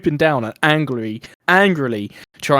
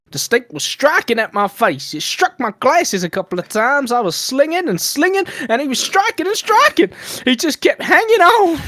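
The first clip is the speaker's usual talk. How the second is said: Texan voice building higher and louder to the end; Texan lady getting more worked up, climbing higher; Texan accent; 12 semitones higher, 5 dB louder than usual. Acted. Reading the victim's quote to mates, Texan accent rising. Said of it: "should I do the accent?".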